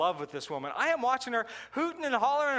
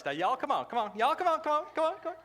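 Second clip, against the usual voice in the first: higher, cajoling Southern call; coaxing waitress; 7 semitones higher than usual. Retold or imitated. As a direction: wave them in, sing-song and high. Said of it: "waitress calling customers over".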